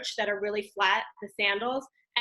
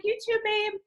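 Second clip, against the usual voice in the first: light mom reply; 11 semitones higher than usual. Retold or imitated. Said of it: "I'm like".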